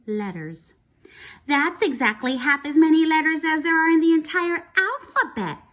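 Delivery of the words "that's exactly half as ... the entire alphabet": higher and louder, showing off; know-it-all exclaim. Acted. Victoria showing off to the teacher, exclaiming. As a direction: blurt it out to impress the teacher. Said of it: "Victoria exclaimed".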